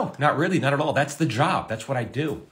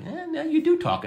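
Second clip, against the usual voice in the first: high-pitched imitation of colleagues; put-on high voice for a retold retort; mimicked retort. Writer retells the room's reaction, high mock voice. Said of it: "don't sometimes always hear it and like".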